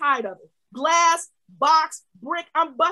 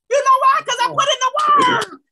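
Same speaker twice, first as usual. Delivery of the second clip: high, very loud triumphant cry; exultant full-force preaching; excited shout; 8 semitones higher, 7 dB louder than usual. Real, not acted. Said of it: "I'm excited about this thing".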